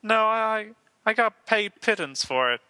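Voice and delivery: high frog puppet voice; Kermit the Frog. Acted. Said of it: "all right, Kermit".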